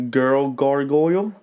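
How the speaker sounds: put-on "Girl gargoyle, guy gargoyle" slowly, each g crisp; steady, deliberate articulation; slow diction drill. Shown as a demonstration. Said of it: "say with me now".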